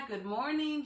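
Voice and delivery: plain reading voice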